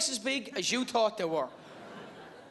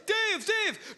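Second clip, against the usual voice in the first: high muffled call through glass; 11 semitones higher than usual. Retold or imitated. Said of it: "I sound like Monica Lewinsky".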